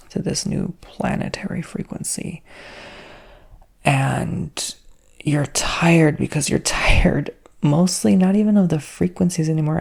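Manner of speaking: normal talking voice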